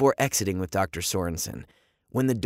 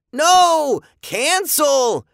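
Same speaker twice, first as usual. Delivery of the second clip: high, loud panicked scream; 7 dB louder, much slower than usual. A narrator's reading. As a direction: scream to stop it. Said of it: "the kids screamed".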